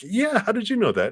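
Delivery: high, delighted disbelief; amazed stranger. Retold or imitated. Stranger marvels that you knew the South African art, high and delighted. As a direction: ask it way up, thrilled to be recognized.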